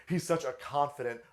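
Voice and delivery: normal talking voice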